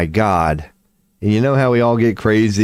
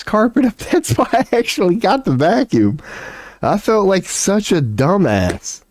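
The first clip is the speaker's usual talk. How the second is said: laughing at himself; 10 semitones higher than usual. Real, not acted. Laughing at his own mistake.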